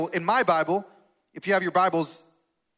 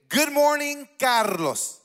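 raised "Good morning" in a South Carolina accent; hammed-up South Carolina accent; 6 semitones higher, much slower than usual. Shown as a demonstration. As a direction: model a South Carolina greeting for the crowd. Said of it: "in your best South Carolina accent".

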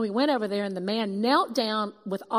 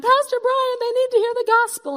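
much higher and louder shout, rushed at the end; calling out to the pastor for help; yelled call; 9 dB louder than usual. Retold or imitated. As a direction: holler it urgently across the crowd. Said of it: "I yelled for Pastor Brian".

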